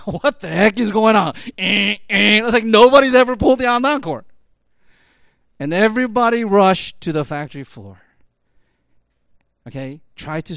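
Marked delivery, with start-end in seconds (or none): 0.1-4.3 loud, higher, startled uproar; acted-out alarm. Workers reacting to the stopped line, loud alarm. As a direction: shout it like a crowd rushing in.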